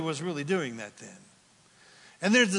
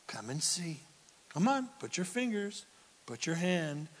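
whispered, gentle; Jesus whispering to Thomas; hushed invitation; 6 dB quieter than usual. Retold or imitated. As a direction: invite him in softly.

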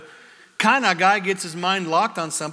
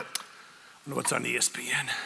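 softer, lower mumble to himself; mock distracted mumble; 6 semitones lower, 10 dB quieter than usual. Adam mumbling, distracted, ignoring the devil, softer. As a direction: tune out like a bored dude.

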